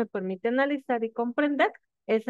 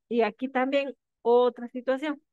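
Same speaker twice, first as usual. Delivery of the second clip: ordinary delivery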